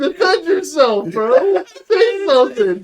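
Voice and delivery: high, loud laughter through words; cracking up, barely able to speak; laughing. Real, not acted. Cracking up at friend's insult.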